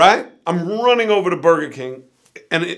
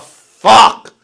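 sudden loud, high swear; exasperated curse; 5 semitones higher, 10 dB louder than usual. Retold or imitated. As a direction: curse out loud as your plan falls apart. Watching the girls drive off.

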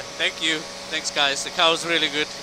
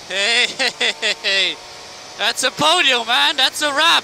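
loud, high podium celebration; excited shouting; 5 semitones higher, 4 dB louder than usual. Real, not acted. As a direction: shout it out, thrilled.

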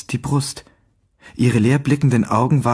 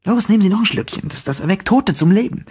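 put-on much higher, fast, jovial old beggar; cheery beggar; 6 semitones higher than usual. A narrator's reading. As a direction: push the drink on him with a grin. Beggar urges another sip.

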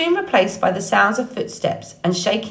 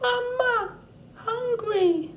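put-on much higher, tiny chirping baby voice; peeping gosling; 5 dB quieter, much slower than usual. A narrator's reading. Gosling calling to Roz for food, high peeping. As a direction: peep it like a hungry chick. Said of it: "Brightbill started peeping".

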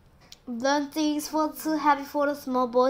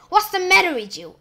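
high, loud, fast screech; 6 semitones higher, 8 dB louder, faster than usual. Acted. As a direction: screech it at the boy. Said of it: "and Spiker screeched".

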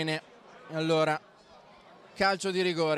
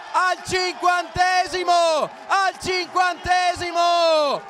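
much higher and louder, names stretched out slowly; goal celebration shout; 10 semitones higher, 6 dB louder, slower than usual. Real, not acted. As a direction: scream the late winner with pure joy. Late winner, commentator erupts.

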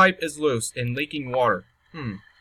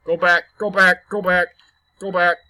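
panicked; 5 dB louder than usual. Real, not acted. Fleeing the monster.

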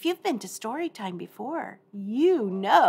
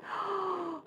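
surprised gasp; 9 semitones higher, 7 dB quieter than usual. Pretend. Finding the mouse.

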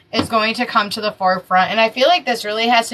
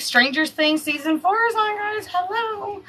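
high, soft singsong reminder; excited; 10 semitones higher, 4 dB quieter than usual. Real, not acted. Reader nudging viewers about a new Netflix season, excited. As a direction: pitch climbs as the volume drops away.

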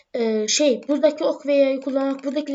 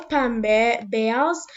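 ordinary talk

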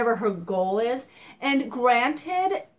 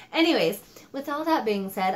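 normal talking voice

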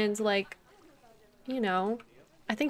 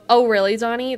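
surprised; 9 dB louder than usual. Real, not acted. Reacting to chat's bad news.

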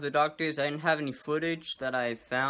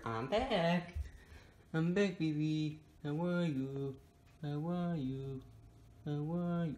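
pet talk; 4 semitones higher, 6 dB quieter, slower than usual. Real, not acted. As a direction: greet the pet sweetly. Greeting pet Oscar.

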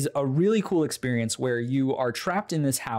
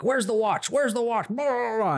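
high, insistent repeated question; mock demanding cop; 8 semitones higher than usual. Cop interrogating the handcuffed husband, mock insistent. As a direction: badger like a pushy cop. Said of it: "he's like".

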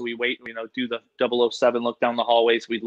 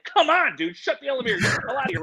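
much higher, pushy hurry-up; urgent plea; 11 semitones higher, faster than usual. Retold or imitated. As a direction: beg the door to close, fast and high. Urging the kid to leave.

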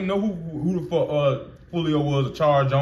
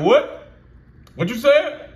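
higher, louder retold challenge; imitating a confrontational youngster; mock challenge; 10 semitones higher, 5 dB louder, slower than usual. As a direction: toss it back like a kid picking a fight. Retold street confrontation, kid challenging.

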